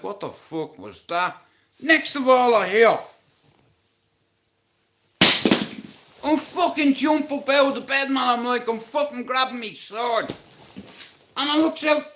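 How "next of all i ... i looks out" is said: pitched higher and louder, panicked telling of jumping out of bed; excited retelling. Retold or imitated. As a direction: tell it like you've just been jolted awake. Retelling startled waking from dream.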